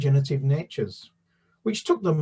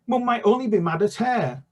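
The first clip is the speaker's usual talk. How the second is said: piping high voice; piped up; 8 semitones higher than usual. A narrator's reading. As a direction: pipe up brightly, higher. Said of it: "Em piped up".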